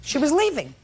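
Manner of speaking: scolding her, the pitch climbing high; sharp scolding. Real, not acted. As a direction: cut her down with a pointed high rebuke.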